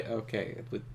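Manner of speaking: ordinary talk, nothing marked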